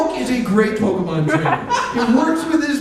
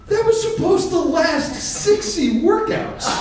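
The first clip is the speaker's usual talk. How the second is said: high, slow outraged disbelief; stunned that it's all gone; shocked clerk; 9 semitones higher, slower than usual. Retold or imitated. Vitamin clerk stunned the supplement is gone, high disbelief. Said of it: "He was like".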